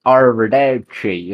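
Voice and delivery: put-on Arrivederci slow, in a thick English accent. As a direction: drag out Arrivederci like a clueless Englishman. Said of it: "with the thickest English accent ever".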